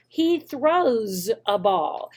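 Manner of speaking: "He throws a ball" stretched out very slowly, every word separate; very slow correction. Shown as a demonstration. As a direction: say it very slowly so the child can copy. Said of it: "point to your mouth".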